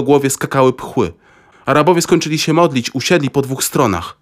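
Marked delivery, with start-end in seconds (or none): none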